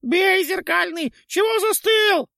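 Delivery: very high, loud ghoul shriek. A narrator's reading. Ghoul shrieks at the knight to strike, very high. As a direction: scream the order to strike.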